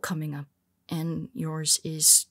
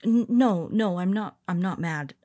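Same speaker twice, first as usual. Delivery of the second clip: flustered denial; 5 dB louder than usual. Acted. Flustered denial after rival's confession.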